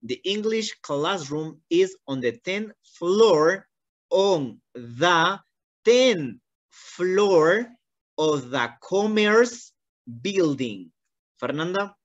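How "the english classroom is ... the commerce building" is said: slow, spaced-out teacher reading; slow model reading. Shown as a demonstration. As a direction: read it slowly for her again. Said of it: "Vuelvo a repetir una vez más". (lectura modelo lenta)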